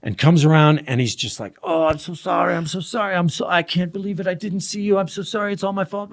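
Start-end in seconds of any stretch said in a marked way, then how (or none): 1.6-6.1 begging. Retold or imitated. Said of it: "almost like begging for my forgiveness".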